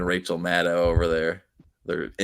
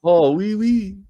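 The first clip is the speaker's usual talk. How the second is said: high, loud mock-French "oui, oui"; 11 semitones higher, 4 dB louder, slower than usual. Teasing a chatter as a Frenchman with mock French. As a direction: chirp it like a cartoon Frenchman. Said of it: "Fucking Frenchman".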